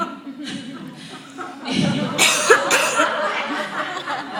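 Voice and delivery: big high burst of laughter; loud laugh. Real, not acted. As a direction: crack up at your own story. Laughing at the sheets story.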